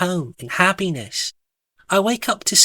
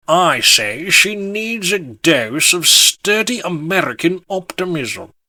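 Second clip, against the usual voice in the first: pompous knight holding forth; pompous gentleman; 3 semitones higher, 3 dB louder than usual. A narrator's reading. Sir Pardon Smith prescribing optimism for Elise, pompous. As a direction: hold forth grandly and roundly.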